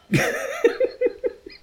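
high-pitched burst of real laughter; helpless laugh. Real, not acted. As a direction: break up laughing mid-reading. Reader breaks up laughing mid-reading.

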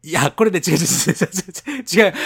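laughing protest, talking over him; flustered denial. Real, not acted. Denying Kansai slang accusation. (慌てて必死に否定する)

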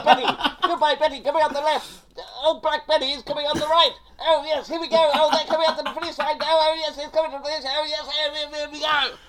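high, loud RP race commentator; horse race commentator. Retold or imitated. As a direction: call the race in an old RP voice. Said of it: "like a horse racer".

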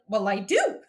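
a dry, loud put-down; deadpan comeback. Retold or imitated. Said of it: "And Ted is like".

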